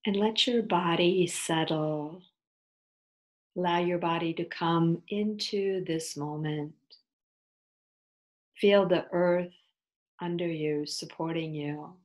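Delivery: unhurried, soothing yoga nidra guidance with long pauses; guided meditation. Acted. Teacher leading listeners into yoga nidra, guided meditation voice.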